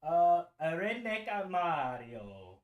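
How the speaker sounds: put-on a drawn-out Mario catchphrase in an Italian accent; playful mock Italian accent. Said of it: "the Italian accent the whole time".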